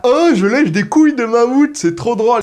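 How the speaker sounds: put-on high, giddy childish squeal of a kid sucking candy; mock kid voice. The host mocks kids licking mammoth candies, high childish squeal. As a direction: play the silly kid thrilled with his candy. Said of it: "On disait là".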